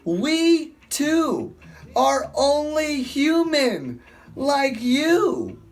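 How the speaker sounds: high, slow and pleading apostle voice; slow, lifted plea to the crowd; Paul preaching. Acted. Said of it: "Paul shouting".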